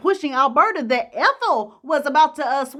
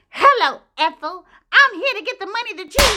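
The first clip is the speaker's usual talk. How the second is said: high, quick, sugary put-on voice; sing-song fake politeness at the door; mock sweet caller; 7 semitones higher, 5 dB louder than usual. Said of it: "the woman is like".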